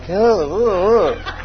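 repeated fed-up groaning; mock exasperated groans. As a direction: groan as if sick of hearing it. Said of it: "I was like".

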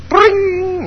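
high sung harp twang; mock harp note; harp strum sound. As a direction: imitate a plucked harp, high. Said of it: "how he played on the harp".

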